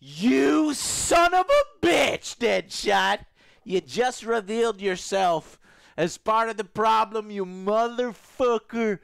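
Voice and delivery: loud, slow furious rant; shaking with anger. Pretend. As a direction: rage at him, words dragged out. Mock rant at early-access streamer.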